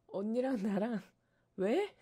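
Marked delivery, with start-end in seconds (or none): 1.6-1.9 higher, louder mock child questioning; playful mock why, rising louder; pestering why. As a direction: imitate nagging kids. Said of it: "그러면 엄마가".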